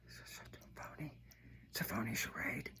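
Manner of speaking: hissed whisper of contempt; scornful whisper. Real, not acted. Host sneering at the convention, scornful whisper. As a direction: whisper it like a dirty secret.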